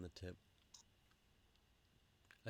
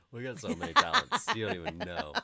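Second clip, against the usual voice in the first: laughing; 3 semitones higher, 12 dB louder than usual. Real, not acted. Joking brag between laughs.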